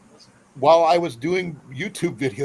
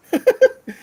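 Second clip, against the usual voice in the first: burst of laughter; 10 dB louder than usual. Real, not acted. Laughing at himself.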